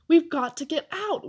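ordinary delivery

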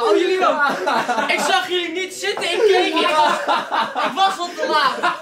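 laughing, startled. Real, not acted. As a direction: laugh through the scare. Startled by hidden friends, laughing.

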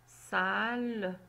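me donne le mot lasso drawn out slowly; slow blending. Shown as a demonstration. Teacher to child, slow blending into a word. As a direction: stretch each word as you build the word. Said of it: "teacher blending syllables into a word".

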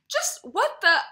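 very high and loud, cut off mid-phrase; sputtering anger. A narrator's reading. A man too furious to finish his sentence at her. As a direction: choke on the outrage. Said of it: "I'm so angry".

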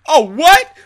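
high loud outraged cry; indignant yell. Retold or imitated. Student imagining confronting teacher over slur, outraged shout. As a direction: shout it down, scandalised. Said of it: "if I would have been like".

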